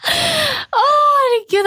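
embarrassed. Real, not acted. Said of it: "jeg blir flau".

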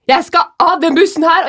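high, loud frightened cry; panicked mother. Retold or imitated. Mom on the rolling bus, a high frightened shout. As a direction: demand to get off, panicking.

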